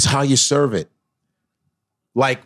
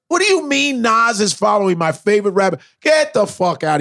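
high disbelieving; 8 semitones higher than usual. Retold or imitated. Disbelief at news.

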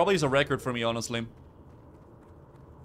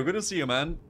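normal talking voice

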